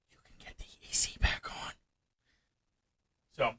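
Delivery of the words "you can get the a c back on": whispered voice of a scheming brain; conspiratorial whisper. Acted. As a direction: whisper it like a tempting secret. Hyper brain's secret promise.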